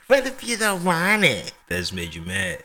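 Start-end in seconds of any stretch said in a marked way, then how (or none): 0.1-1.5 high-pitched parody of a parent; mocking parent voice. Co-host echoing the complaining parent for laughs, high mocking voice. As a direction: mimic the objection way up high.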